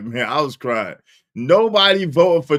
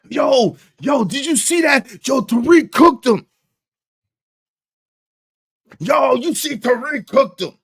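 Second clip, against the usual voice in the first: hyped; 8 semitones higher than usual. Real, not acted. Streamer hyping chat about Tariq's burn, high and excited.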